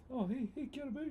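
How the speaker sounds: put-on high, cheery Māori accent greeting; friendly Māori voice. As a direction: greet him warmly, Kiwi Māori style. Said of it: "Said in a friendly Māori voice".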